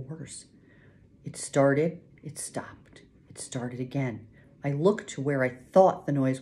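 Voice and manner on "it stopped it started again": plain reading voice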